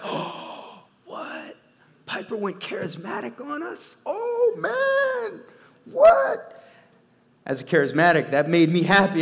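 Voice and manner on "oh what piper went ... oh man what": whispered gasp, then a squeaky, much higher, louder "what?"; stunned disbelief. Retold or imitated.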